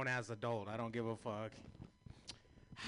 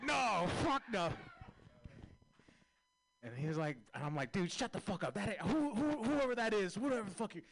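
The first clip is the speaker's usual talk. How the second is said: flustered denial; 10 semitones higher, 6 dB louder than usual. Retold or imitated. Caught robber denying his name, loud and flustered. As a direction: bluster to hide who he is.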